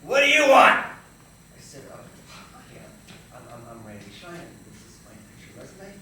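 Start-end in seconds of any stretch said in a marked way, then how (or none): 0.0-0.9 high, very loud snarl; angry old agent. Acted. Old agent to actor at her door, furious yell. 1.9-5.9 soft, hesitant introduction; timid stammer. Acted. Nervous young actor to fierce agent, meek stammering.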